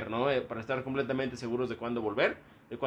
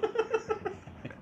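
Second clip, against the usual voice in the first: hiccuping laugh; 7 dB quieter than usual. Real, not acted. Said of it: "Con hipo y todo".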